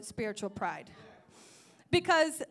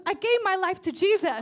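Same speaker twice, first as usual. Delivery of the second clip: louder, lifted retelling of joyful news; excited announcement; 4 semitones higher, 6 dB louder than usual. Retold or imitated. Ex-psychic shares her conversion with the preacher, excited announcement. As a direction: announce it with rising excitement, short of a full shout.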